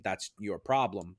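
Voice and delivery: normal talking voice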